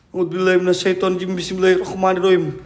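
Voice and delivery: low, quick murmured prayer; prayer recitation. Real, not acted. Ghost hunter murmuring prayer before challenging spirits. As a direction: recite it fast and low like a shield.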